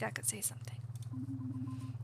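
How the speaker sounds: whispering. Acted. Recovering from panic.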